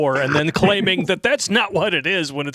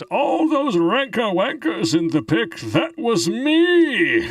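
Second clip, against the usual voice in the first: higher, slow, drawn-out put-on Bane voice; 10 semitones higher, 3 dB louder, much slower than usual. Reading a viewer's chat message to the stream, in a slow Bane voice. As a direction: read the chat like Bane, stretching the end. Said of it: "in the Bane voice".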